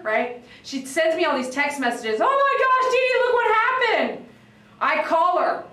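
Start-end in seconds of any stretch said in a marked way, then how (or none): 2.2-4.3 high, loud panicked owner voice. Retold or imitated. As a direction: squeal it like the upset dog owner.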